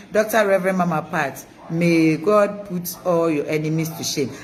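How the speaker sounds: ordinary talk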